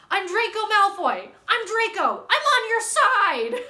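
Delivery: high, loud mock-desperate plea; panicked pleading. Vlogger acting a boy begging for his life, panicked pleading. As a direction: cry it out, begging to be spared. Acted pleading quote from the book.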